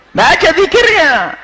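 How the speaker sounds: slow, high stretched command; drawn out emphasis. Real, not acted. Preacher urging elders to recite dhikr, slow and high. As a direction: stretch it out to drive the point home. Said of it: "urging elders to recite dhikr".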